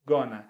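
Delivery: "gonna" said as one blended word, go-na; relaxed spoken "gonna". Shown as a demonstration. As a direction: run "going to" together into "gonna". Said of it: "going to fica gonna".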